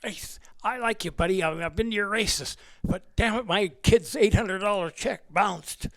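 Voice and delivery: be the upset Alcatraz warden dad; angry dad on phone. Retold or imitated. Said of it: "He called me up and he says".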